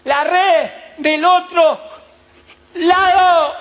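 high, loud, slow call across the water; shout from the shore. Acted. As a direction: bellow it across to the fishermen. Stranger insists from the shore.

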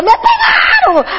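put-on high, slow whining kid's yell with a laugh; child tattling. Nephew tattling to the aunt, a slow high whining yell. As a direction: wail like a kid who got hit.

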